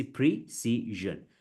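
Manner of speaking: PRECISION slowly as pree, see, zhun; syllable-by-syllable. Shown as a demonstration. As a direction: break "precision" into three beats. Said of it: "you break it".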